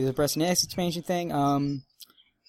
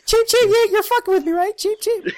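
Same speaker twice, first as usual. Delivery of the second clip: high squeaky bird chirps; cartoon bird voice; 7 dB louder than usual. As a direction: chirp back at the birds. Answering the birds.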